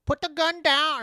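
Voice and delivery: very high, loud Fargo-style Minnesotan; Fargo accent. Retold or imitated. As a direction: mimic the Fargo police lady, shrieky high. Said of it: "that scene in Fargo when she's just like".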